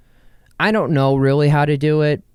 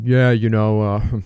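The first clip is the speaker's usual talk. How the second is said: business exec; 4 semitones lower than usual. Retold or imitated. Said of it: "no business person is going".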